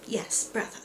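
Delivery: whisper. Acted. Submissive reply to stern brother.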